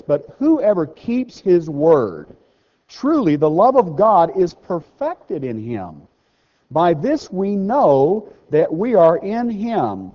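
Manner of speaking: slow, loud solemn reading; measured preacher's scripture read. Acted. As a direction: proclaim the verse slowly.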